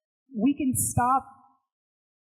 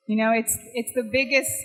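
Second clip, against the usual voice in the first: ordinary talk, nothing marked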